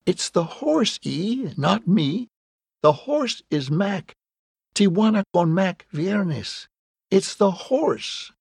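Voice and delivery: high, eager, slightly winded; urgent, high-pitched explaining, a touch breathless; a little breathless. A narrator's reading. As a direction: insist excitedly with the volume held back. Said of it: "and a little breathless".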